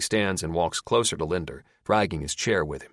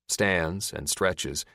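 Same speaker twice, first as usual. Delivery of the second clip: ordinary delivery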